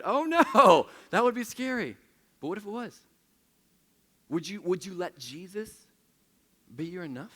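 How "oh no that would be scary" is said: loud, high mock panic breaking into a laugh; mock fright, laughing. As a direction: play scared while laughing at yourself. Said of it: "me included".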